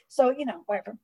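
ordinary talk

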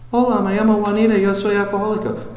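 fast, high voice of a woman in Spanish; woman's voice. Retold or imitated. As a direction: play the woman greeting in Spanish. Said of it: "hands the phone to the next guy".

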